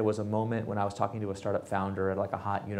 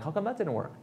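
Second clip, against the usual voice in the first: high, puzzled mock question; mock baffled; 10 semitones higher than usual. Big company baffled that its huge team failed, high mock puzzlement.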